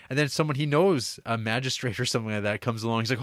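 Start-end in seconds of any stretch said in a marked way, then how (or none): none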